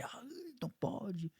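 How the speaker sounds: high, thin squeak of mock horror; mock prudish gasp. Mocking the strict church crowd, a squeaky prudish gasp.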